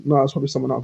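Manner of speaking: ordinary talk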